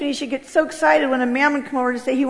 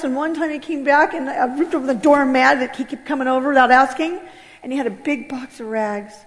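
normal talking voice

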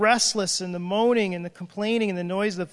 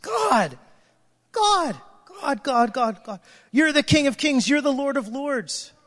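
pleading cry to God; 5 semitones higher than usual. Retold or imitated. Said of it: "And I'm just like".

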